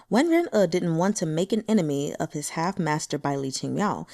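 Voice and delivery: plain reading voice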